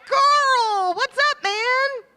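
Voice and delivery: loud, high, casual shout to a buddy; rowdy pizza-party greeting. Pretend. Calling to Carl across the pizza table, loud and casual. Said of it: "we can be like".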